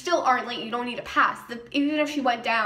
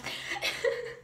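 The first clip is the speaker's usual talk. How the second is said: breathless silent laugh; 10 dB quieter than usual. Real, not acted. Can't stop laughing in class.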